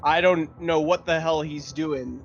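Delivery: ordinary delivery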